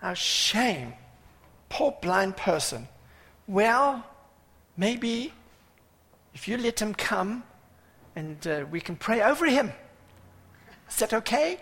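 high, hesitant politically correct voice; mock self-righteous pity. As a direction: condescend politely, lifting into tentative questions. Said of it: "politically correct, self-righteous".

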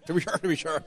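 light, laughing stage-Irish lilt on the catchphrase; jokey Irish voice, half-laughing; mock Irish accent. Preacher joking to the congregation, mock Irish accent. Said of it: "That's more Irish".

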